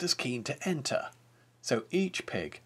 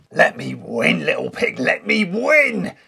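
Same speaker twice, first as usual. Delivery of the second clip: wolf bellowing at the pig; shouting; 5 semitones higher, 10 dB louder than usual. A narrator's reading. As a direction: yell him off the runway. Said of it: "he shouted".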